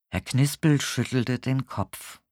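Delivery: ordinary delivery, nothing marked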